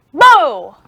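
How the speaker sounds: a loud yelled boo; yelling voice. Shown as a demonstration. Teacher showing the yelling voice for boo, loud. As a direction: shout the boo out. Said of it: "our yelling voice".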